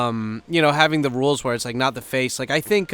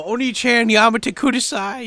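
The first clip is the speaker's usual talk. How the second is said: higher, strained mock anime voice; mock anime quote; 10 semitones higher than usual. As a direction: parrot an anime line in a squeaky voice. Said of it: "the only Japanese he knew was from hentai".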